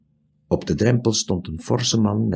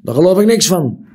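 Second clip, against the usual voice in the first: loud, high sneering dismissal; scornful; 9 semitones higher, 7 dB louder, faster than usual. A narrator's reading. Scornful retort. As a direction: owner scorns the boy's story. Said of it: "smaalde de man".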